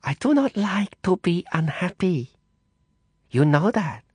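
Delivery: high, breathy threatening whisper; menacing whisper. Acted. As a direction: hiss a veiled threat from the shadows. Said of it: "Madame Zhou".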